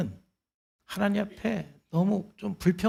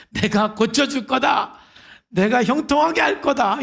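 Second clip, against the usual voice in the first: hoped-for answer from God; 7 semitones higher, 8 dB louder than usual. Pretend. Preacher voicing the answer Habakkuk wanted, loud high proclamation. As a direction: proclaim it like God's grand promise, loud and high. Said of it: "이런 응답이 와야 되는데".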